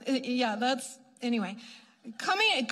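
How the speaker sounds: normal talking voice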